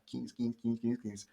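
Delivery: soft, low repeated chant; repetitive drilling. Acted. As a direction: murmur it over and over like rote practice. Mimics drilling the leftover fifteen cards.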